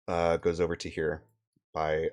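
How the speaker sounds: ordinary talk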